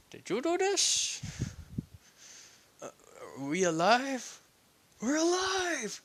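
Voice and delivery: rising to a high, thrilled squeal; stunned joy. Acted. Momo realizing they survived, high and thrilled. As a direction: disbelief turning to delight.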